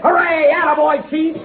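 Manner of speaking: high, loud whoop of joy; excited cheer. Acted. Clark cheering the chief for joining the trip, loud and high. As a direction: cheer him on at full voice.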